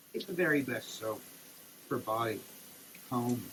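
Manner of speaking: normal talking voice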